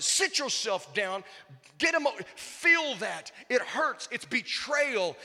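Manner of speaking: ordinary talk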